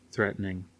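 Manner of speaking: ordinary delivery, nothing marked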